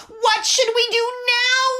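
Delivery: high loud panicked holler. A narrator's reading. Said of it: "he hollered".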